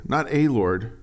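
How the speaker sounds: ordinary talk, nothing marked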